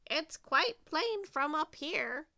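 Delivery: high crow character voice; insistent bird voice; squawking crow. A narrator's reading. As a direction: squawk down from above. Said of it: "squawked crow".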